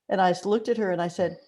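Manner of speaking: normal talking voice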